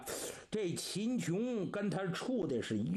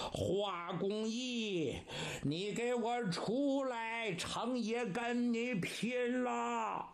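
slow, long-drawn battle call; calling out challenge; 3 semitones higher, slower than usual. Acted. Cheng Yaojin daring Hua Gongyi to fight, drawn-out call. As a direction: stretch each word as if hailing the walls.

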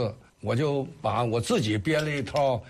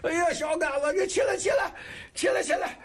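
retold high-pitched startled urging; flustered surprise; 14 semitones higher than usual. Retelling how the startled master urged the kneeling pupil up, flustered high voice. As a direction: imitate a taken-aback old master, voice jumping way up. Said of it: "当时他也当时懵住了".

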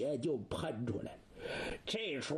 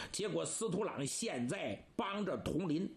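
ordinary delivery, nothing marked

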